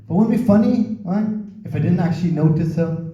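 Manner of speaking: normal talking voice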